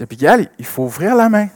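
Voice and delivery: father insisting. Retold or imitated. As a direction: tell the child it's time to pay. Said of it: "je dis".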